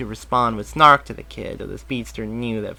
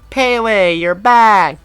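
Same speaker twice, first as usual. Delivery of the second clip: put-on high, loud joyful cry of a kid; boy cheering; 9 semitones higher, 12 dB louder, much slower than usual. A narrator's reading. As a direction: cheer like a boy who found his dog. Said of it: "the boy cheered".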